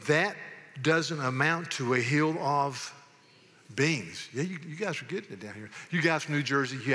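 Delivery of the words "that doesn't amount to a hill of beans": emphatic, slow folksy quote; slow saying, pausing before the last word; drawn-out Southern saying. Acted. Reciting Southern sayings as a test.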